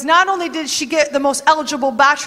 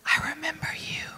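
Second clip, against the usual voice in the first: soft unvoiced whisper; 13 dB quieter than usual. God whispering over Ruth, a soft whisper. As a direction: breathe it tenderly, barely a sound. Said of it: "He whispers once again".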